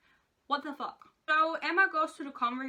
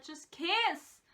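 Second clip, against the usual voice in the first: pleading burst, pitched up; exasperated urging; 6 semitones higher than usual. Retold or imitated. Reader yelling at the book's couple, excited. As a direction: throw up your hands at the page.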